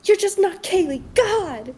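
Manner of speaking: hammed-up diva yell; mock yell. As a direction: throw a dramatic little tantrum at her. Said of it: "yells at her".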